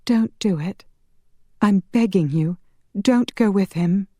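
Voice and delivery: high, tearful plea; begging. Acted. Tearful heroine begs Connor.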